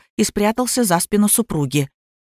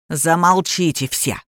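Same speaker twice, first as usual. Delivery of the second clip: ordinary delivery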